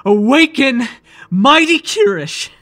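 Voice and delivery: full-voiced ecstatic invocation; summoning shout. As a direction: bellow the final command to awaken. Climax of summoning ritual.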